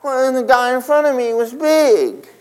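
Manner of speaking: lineman's excuse. Retold or imitated.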